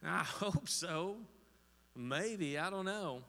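slow, hesitant, with a nervous laugh; unsure hopeful answer. Pretend. Congregant unsure about heaven, answering with hesitant uncertainty. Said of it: "If you're answering that".

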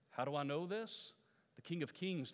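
ordinary delivery

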